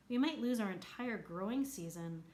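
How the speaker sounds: plain reading voice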